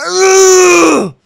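high, loud mock grunt of pain; hammed-up pain groan. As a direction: shout out the villain's hurt groan.

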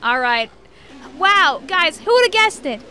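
normal talking voice

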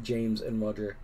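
ordinary delivery

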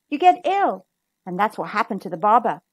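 usual speaking voice

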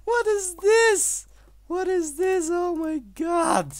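very high, slow, drawn-out cry of disbelief; shocked shriek. Real, not acted. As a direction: squeal it out as if the screen just shocked you. Shocked by an unknown map.

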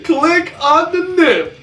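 slow squeaky high chant of Click on the nip; goofy high slogan voice; high slow sing-song. Pretend. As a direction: stretch it out up high like a jingle.

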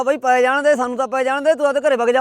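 loud brush-off through the door; shouting him away. Acted.

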